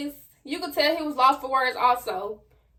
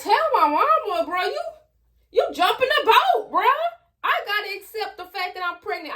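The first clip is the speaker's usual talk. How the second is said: high, loud mock-outraged retort to a friend; sassy comeback; 8 semitones higher, 3 dB louder than usual. Teen snapping back at her friend over telling mama, high and loud.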